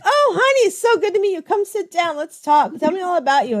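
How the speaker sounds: high, syrupy overfriendly greeting; fake sweet. Mom greeting a girl she dislikes, sugary high. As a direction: gush with fake warmth.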